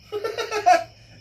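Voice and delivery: loud high cackle; high burst of laughter. Real, not acted. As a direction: crack up at your own line. Laughing at own joke.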